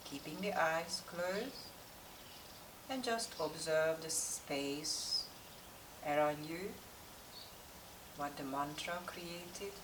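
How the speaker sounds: calm, unhurried yoga meditation with long pauses; guided meditation. Real, not acted. Yoga teacher settling class before practice, guided meditation voice. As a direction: guide the class inward, leaving space between instructions.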